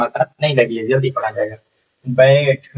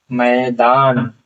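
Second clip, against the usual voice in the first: मैदान very slow, long दान; teacher modelling मैदान slowly; slow sounding out; much slower than usual. Shown as a demonstration. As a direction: drag मैदान out syllable by syllable. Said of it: "मैदान लिखना होता तो ऐसे लिख देते".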